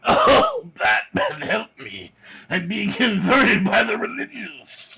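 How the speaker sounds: mock pleading villain, a hint of Joker. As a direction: whine to Batman for help. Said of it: "Joker, take your meds".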